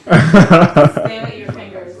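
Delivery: slow, loud laughing brush-off; dismissive, laughing. Real, not acted. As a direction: drag it out, laughing them off. Repeats dismissal laughing, slower.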